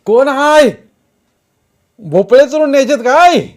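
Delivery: high, loud farmer's challenge; full-voiced accusing holler; shouting. A narrator's reading. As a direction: yell across the field at suspected thieves. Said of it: "Wamandada ओरडू लागला".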